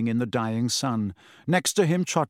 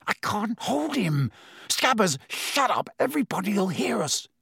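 high, strained panic; panicked; 7 semitones higher than usual. Acted. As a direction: plead with the rat in panic. Ron panicking.

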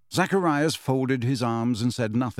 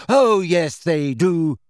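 loud, high, indignant contradiction; heated, emphatic comeback; angry; 5 semitones higher, 6 dB louder, slower than usual. A narrator's reading. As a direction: insist angrily that it's true. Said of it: "said Luna angrily".

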